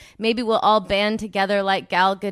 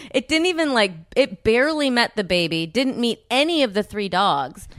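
ordinary talk, nothing marked

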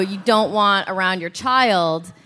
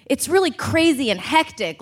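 usual speaking voice

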